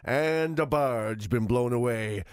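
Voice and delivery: deep, slow announcer voice; fighting game announcer. Retold or imitated. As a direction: sink low and drag it out, ending in a whisper. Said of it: "the full announcer voice".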